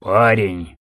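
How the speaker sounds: low wounded rasp; hoarse rasp. Acted. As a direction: rasp it out weakly. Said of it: "прохрипел боец".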